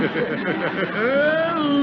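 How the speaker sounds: high, rolling chuckle of glee; delighted laugh. Acted. Host laughing as guests grab their shirts, gleeful chuckle.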